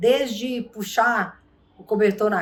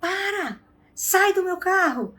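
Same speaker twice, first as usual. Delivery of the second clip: angry yell; 10 semitones higher than usual. Retold or imitated. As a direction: throw her out of the car. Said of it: "ele só queria brigar". (grito furioso, voz bem mais aguda)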